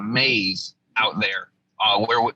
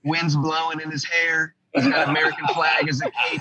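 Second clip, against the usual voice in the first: a hint of a dramatic trailer voice; mock heroic narrator; 4 semitones higher than usual. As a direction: tease him like a hero on screen. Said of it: "But they were like".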